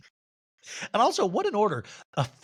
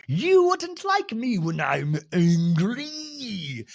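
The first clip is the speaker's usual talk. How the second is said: slow, heavy Southern Hulk threat, pitched up; dialect Hulk; 6 semitones higher, 3 dB louder, much slower than usual. Retold or imitated. Recapping a reality show, she mimics Meredith as a drawling Hulk. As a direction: growl it out like a drawling Hulk about to snap. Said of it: "the dialect Hulk".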